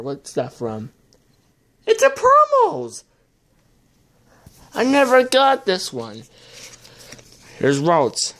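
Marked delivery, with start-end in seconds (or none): 1.9-3.0 much higher, louder squeal of joy; excited squeal. Real, not acted. Finding a rare promo card, squealing. 4.7-6.2 higher, eager pitch on never; delighted to finally have it; excited. Real, not acted. Showing a card never owned before, excited.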